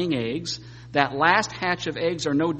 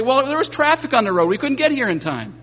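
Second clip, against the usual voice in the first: arguing back; 8 semitones higher, 6 dB louder than usual. Pretend. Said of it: "Start arguing, say".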